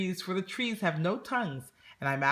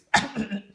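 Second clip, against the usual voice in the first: cough; 6 semitones higher, 6 dB louder than usual. A narrator's reading. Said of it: "pardon my cough".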